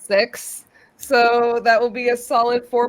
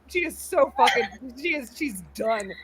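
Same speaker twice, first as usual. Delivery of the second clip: normal talking voice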